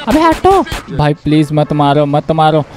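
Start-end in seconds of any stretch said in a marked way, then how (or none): 0.1-0.6 high-pitched urgent order to move; alarmed cry. Real, not acted. Yelling at pursuers during car chase.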